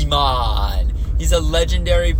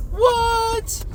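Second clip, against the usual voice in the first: high squeaky what; mock surprise. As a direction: act astonished. Mock surprise at Worlds news.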